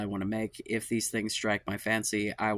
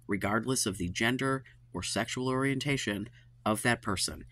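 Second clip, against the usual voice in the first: usual speaking voice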